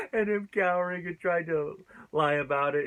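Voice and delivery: high, chuckled delivery; laughing. Real, not acted. Reviewer recalling Basil cowering and lying, laughing. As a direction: talk through a laugh.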